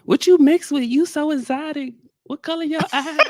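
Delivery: put-on high, gushing girly voice; mock swooning woman. Women fawning over his eyes, in a high gushing girly voice.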